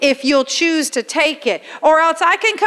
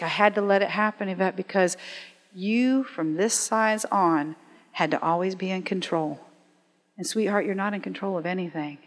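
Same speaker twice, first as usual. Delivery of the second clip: soft, gentle, reassuring; tender voice of God; 5 semitones lower, 8 dB quieter than usual. Retold or imitated. God speaking tenderly to Yvette, soft and reassuring. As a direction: speak softly like a loving father. Voicing God's tender reply.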